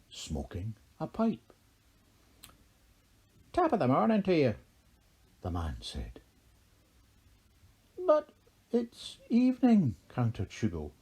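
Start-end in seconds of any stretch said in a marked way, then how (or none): 3.5-4.5 quick, high, loud Irish leprechaun greeting; leprechaun's broad Irish lilt, pitched up; broad Irish accent. A narrator's reading. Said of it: "in a very broad Erin accent". 8.0-9.9 high-pitched, loud character protest; contradicting, pitched way up; countering objection. A narrator's reading. Haggis contradicts the leprechaun's greeting.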